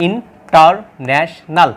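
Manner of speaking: International slowed right down, each syllable apart. Shown as a demonstration. Teacher counting four syllables for students, slowly. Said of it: "এখানে চারটা".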